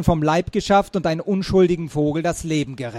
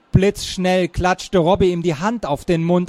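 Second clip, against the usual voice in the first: ordinary delivery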